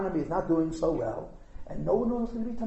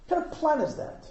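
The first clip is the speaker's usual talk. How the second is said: the repeat pitched higher, incredulous; higher mock disbelief; 7 semitones higher than usual. Real, not acted. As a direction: scoff at it, pitched up. Repeated mocking question.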